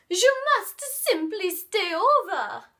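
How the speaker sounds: high, loud gushing fox; sweetly insistent hostess; Foxy inviting. Acted. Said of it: "said Foxy".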